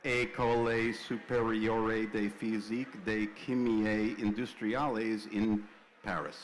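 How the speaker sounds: slow French read with a heavy American accent; American-style French. Shown as a demonstration. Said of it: "I'm gonna do it American style".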